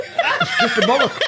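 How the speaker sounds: squeaky high giggle through words; cracking up mid-sentence; laughing. Real, not acted. Repeats it again, cracking up.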